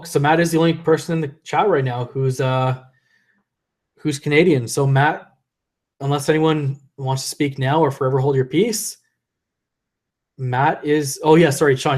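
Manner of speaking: ordinary talk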